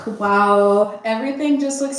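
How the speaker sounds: loud, delighted wow over the clean room. Real, not acted. Showing off the clean bathroom, loud and delighted. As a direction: beam with satisfaction at the shine.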